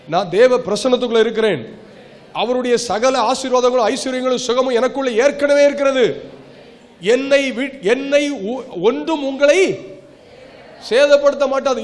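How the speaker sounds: loud, high declamatory preaching; proclamazione di fede. Shown as a demonstration. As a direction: proclaim it forcefully for the congregation to repeat. Declamatory confession of faith to congregation.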